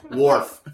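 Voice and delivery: loud "Wharf" with a clear r; emphatic rhotic "Wharf", loud; pronounced r. Shown as a demonstration. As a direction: hit the r in "Wharf". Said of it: "Not like wolf".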